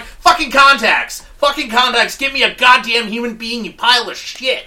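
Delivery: screaming, high and loud; furious shout. Retold or imitated. Caller screaming at the phone robot, furious shout. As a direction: explode at the machine. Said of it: "I'm like".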